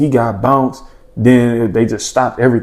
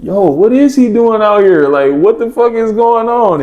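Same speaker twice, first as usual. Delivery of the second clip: excited disbelief; 8 semitones higher than usual. Retold or imitated. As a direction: blurt it out, half laughing at the sight. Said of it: "I'm like".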